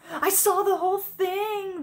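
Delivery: high, loud dragon crowing nastily; nasty triumphant glee. A narrator's reading. Dragon catching out the princess, nasty triumphant glee. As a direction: gloat loudly in a high, gleeful voice. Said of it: "said with nasty, triumphant glee".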